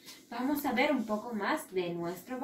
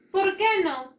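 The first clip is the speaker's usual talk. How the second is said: high, loud burst; lively exclamation; 8 semitones higher, 6 dB louder than usual. Acted. Teacher playfully asking the class why not, lively. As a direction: throw it out brightly to the class.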